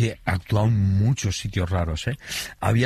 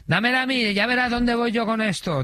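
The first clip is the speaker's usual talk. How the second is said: very high mimicked woman prisoner; heckling inmate; 13 semitones higher than usual. Imitating inmate heckling at pistol. (voz muy aguda imitando a una presa del público)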